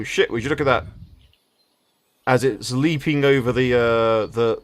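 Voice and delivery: ordinary talk, nothing marked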